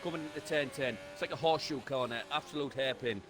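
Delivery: brisk running race commentary; live play-by-play. Acted. Co-driver talking the viewers through the lap, commentary voice. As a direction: call every corner of the lap as it happens.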